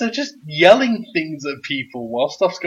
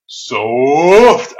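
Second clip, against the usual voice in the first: loud retort; 10 dB louder than usual. Retold or imitated. Byron's retort.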